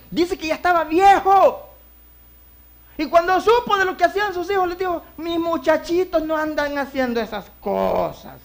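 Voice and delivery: loud, high preaching cry. Acted. Preacher calling out to the congregation, loud. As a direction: preach it out to the church.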